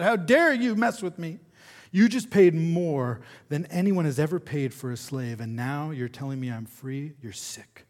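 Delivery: loud, high indignant outburst, dropping at the end; pained outrage. A narrator's reading. Freed slave to her buyer, outraged through painful tears. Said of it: "through painful tears".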